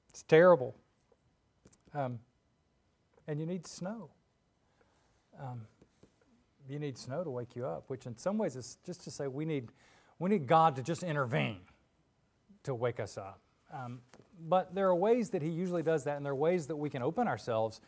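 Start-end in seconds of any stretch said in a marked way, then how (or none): none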